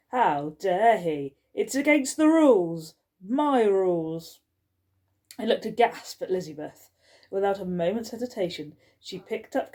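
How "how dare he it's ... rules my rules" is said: rising indignation. Acted. Skullface erupting over broken hotel rules, louder and indignant. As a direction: build the outrage into a loud rant.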